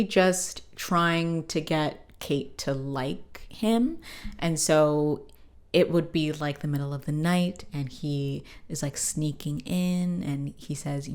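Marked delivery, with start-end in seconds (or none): none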